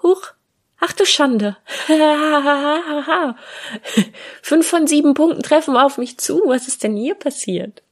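high, loud startled gasp with a laugh; shocked laugh. Retold or imitated. Reading an Instagram post about herself, shocked and laughing. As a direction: act caught off guard by the post. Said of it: "dachte ich".

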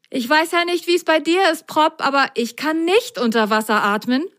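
high-pitched, worried little character; anxious sock Milo. Acted. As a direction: protest urgently that this is a problem. Milo worries about breathing underwater.

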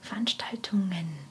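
whispered to herself; whispering. Shown as a demonstration. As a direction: whisper the word while thinking. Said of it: "Comedy-Veranstaltungen rückwärts". (geflüstert)

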